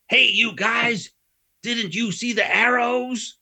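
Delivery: high, slow mock American tourist voice; hammed-up drawl of a puzzled tourist; American driver. Imitating the driver calling from his car window.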